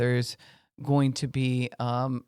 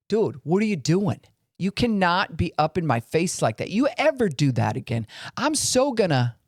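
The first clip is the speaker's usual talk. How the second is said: higher and heated, rising to a threat at the end; angry confrontation; 4 semitones higher than usual. Shown as a demonstration. Showing how drawing a boundary on someone sounds, heated threat. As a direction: get up in their face and threaten. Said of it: "it can sound like this".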